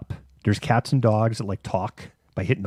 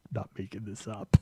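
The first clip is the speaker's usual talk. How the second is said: laughing aside; 7 dB quieter than usual. Real, not acted. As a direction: laugh while insisting it's true. Laughing over the absurd story.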